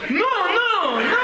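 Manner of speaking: a very high, laughing "No, no, no"; squeaky no. Retold or imitated. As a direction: squeak the refusal in a comic falsetto. His refusal, acted.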